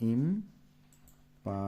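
very slow, drawn-out imperative, split into syllables. Shown as a demonstration. Teacher sounding out a grammar term for pupils, syllable by syllable. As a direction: sound out imperative slowly, pausing between syllables.

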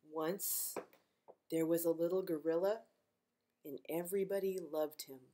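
gentle read-aloud storytime voice; reading to children. Acted. Librarian reading a picture book to kids, gently.

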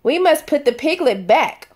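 usual speaking voice

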